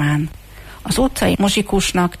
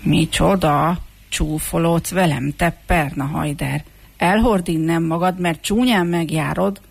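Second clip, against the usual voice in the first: plain reading voice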